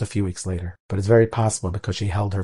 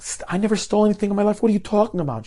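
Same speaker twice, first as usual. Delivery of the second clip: high, rushed protest of innocence; appalled; 10 semitones higher, faster than usual. Retold or imitated. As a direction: blurt it out in disbelief. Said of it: "This mother is appalled".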